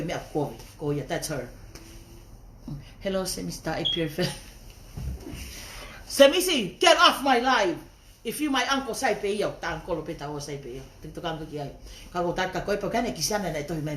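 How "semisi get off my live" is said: louder, slow call-out; playful loud scold at a commenter; mock scolding call. Real, not acted. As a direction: shoo the viewer away loudly and slowly. Telling a viewer off.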